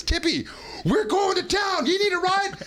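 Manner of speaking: high, slow shouted call to a friend; buddy calling out. Retold or imitated. Buddy shouting to him that they're heading to town. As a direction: yell it high and drawn out. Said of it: "my buddy says".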